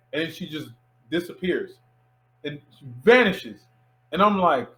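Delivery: ordinary talk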